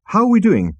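high, loud alarmed question. Acted. Arthur to the crew as the ship careers off, alarmed. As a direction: ask it urgently, voice jumping up.

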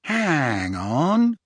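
slow, drawn-out realisation; slowly. A narrator's reading. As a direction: stretch the words out as it dawns on you. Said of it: "Ron said slowly".

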